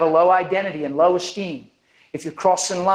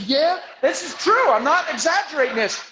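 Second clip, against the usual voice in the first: higher, eager insistence; eager agreement; 9 semitones higher than usual. Retold or imitated. Man with bad feet backs up the healing, eager. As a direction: insist it's true.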